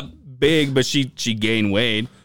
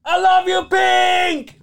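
high loud shout from the back of the crowd; fan's shrill adoring shout; fan yelling; 5 dB louder, much slower than usual. Retold or imitated. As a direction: scream it across the venue to the singer. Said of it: "Angel in the back".

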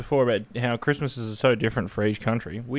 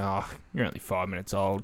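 imitating a Brit; 5 semitones lower, 8 dB quieter than usual. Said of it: "they're like".

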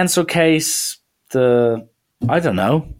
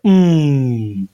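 one long falling hum; drawn-out mock jeer, trailing down; imitated crowd jeer. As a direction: mimic the hall's reaction to a drop. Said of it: "Just, like, drop".

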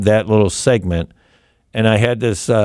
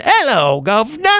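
hammed-up Cockney greeting; English accent; 12 semitones higher than usual. Shown as a demonstration. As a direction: deliver his signature Cockney hello. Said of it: "now I wanna do it again".

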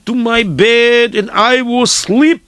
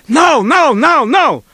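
higher, emphatic string of noes; persistent rabbi; 5 semitones higher, slower than usual. Retold or imitated. Teller showing how the rabbi said it, persistent. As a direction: insist with each repeated word. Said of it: "he said, like".

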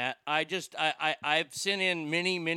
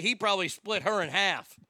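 usual speaking voice

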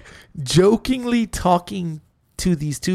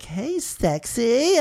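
higher, drawn-out flirty greeting; 7 semitones higher, 3 dB quieter, much slower than usual.

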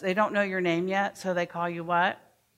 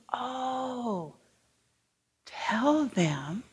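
whispered prompt; 4 semitones higher, much slower than usual. Shown as a demonstration. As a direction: lean in and whisper the cue.

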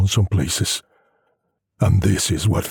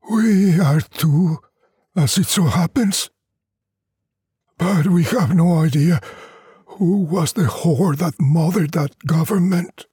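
higher, lightly voiced weary villager, wry; old peasant doubting the schoolmaster, a bit higher; Luvina villagers; 8 semitones higher than usual. Villagers mock the government.

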